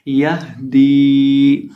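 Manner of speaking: यह दी with the दी held long; lengthened दी. Shown as a demonstration. Teacher shows the long vowel in यह दी. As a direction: stretch the दी in यह दी. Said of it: "लंबा करके पढ़ेंगे".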